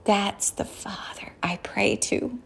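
soft, slow, reverent whisper; hushed whisper. Real, not acted. Preacher confiding whom she prays to, whispered. Hushed aside after the prayer line.

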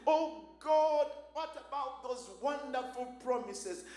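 loud, urgent cries to God, pushing every phrase; impassioned loud prayer. Acted. Jacob begging God for mercy, in a loud impassioned prayer. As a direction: preach it as a desperate plea for mercy. Preaching at full force, voicing Jacob.

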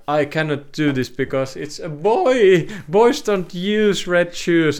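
a hint of a scandalised mother; stern mother. Mother's thoughts voiced in story.

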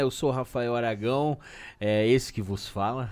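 low radio announcer voice; deep announcer voice. Pretend. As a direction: sign off like a radio MC. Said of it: "gostou dessa, Diogão?".

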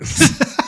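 high, airy breath of laughter; a quick, high breathy laugh. Real, not acted. Laughing after the Spanish class story, high breathy laugh.

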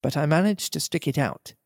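plain reading voice

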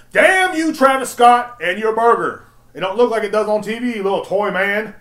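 hammed-up loud shout at the burger; mock outrage. Shown as a demonstration. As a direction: shout it like a betrayed fan. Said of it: "I said".

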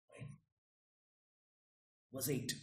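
ordinary talk

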